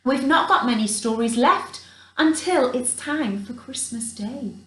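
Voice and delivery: ordinary delivery, nothing marked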